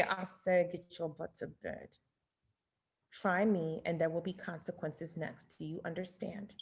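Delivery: creepy, low, hushed threat; whisper voice. Acted. Parent walks upstairs to warn kids, creepy whisper voice. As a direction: drop to a menacing near-whisper, very soft. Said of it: "I'm gonna use my whisper voice".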